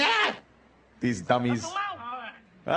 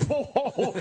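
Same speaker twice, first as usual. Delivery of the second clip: laughing whoa; 9 semitones higher than usual. Retold or imitated. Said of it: "Barnes looks down like".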